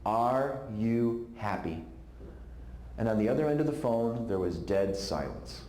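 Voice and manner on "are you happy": very slow, gentle question. Shown as a demonstration. Preacher replaying the one question he asked, slowly. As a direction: let the question hang, slowly. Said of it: "one simple question".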